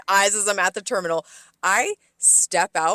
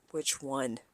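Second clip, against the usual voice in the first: low, soft, deadpan question; mildly condescending; 5 semitones lower, 9 dB quieter than usual. Retold or imitated. Server to vague guests ordering wine, quietly condescending. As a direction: ask it as if they were stupid. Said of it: "like they were stupid for saying it that way".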